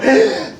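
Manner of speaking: imitating the wife's gasp again, loud and high; a startled intake of breath; mock gasp of alarm; sharp gasp. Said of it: "She'll go".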